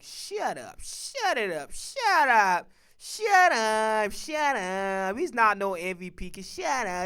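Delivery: drawn-out, falling "shut up" on repeat; mock-wailing dismissal of a bad take; exasperated shut-up. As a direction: stretch each shut up out theatrically. Acts out dismissing others' bad takes.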